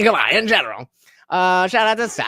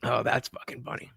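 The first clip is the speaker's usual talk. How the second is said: normal talking voice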